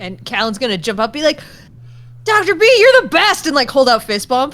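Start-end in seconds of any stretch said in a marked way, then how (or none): 2.2-3.4 high, loud gushing praise; excited cheer. Acted. Player jumps up for a fist bump, excited cheer. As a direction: jump up and cheer him on.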